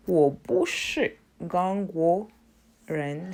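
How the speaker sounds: usual speaking voice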